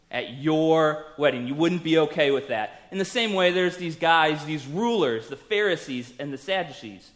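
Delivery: ordinary talk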